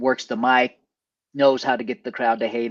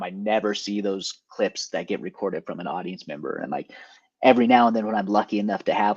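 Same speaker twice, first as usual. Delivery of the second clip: usual speaking voice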